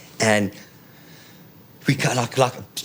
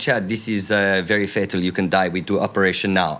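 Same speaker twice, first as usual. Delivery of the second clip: put-on Eastern European doctor accent; imitated Hungarian-accented doctor; doctor's accent. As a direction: warn in broken English with a Budapest accent. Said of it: "She goes".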